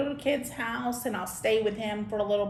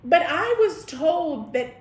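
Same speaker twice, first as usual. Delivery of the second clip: ordinary delivery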